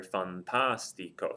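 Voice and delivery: slow fantastico, leaning on the TAS; stressed syllable. Shown as a demonstration. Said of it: "happens to be this A right here".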